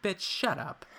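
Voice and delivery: high, sharp, mock-shocked drag queen snap; sassy dismissal. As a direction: toss it off like a diva shutting someone down. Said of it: "And he's like".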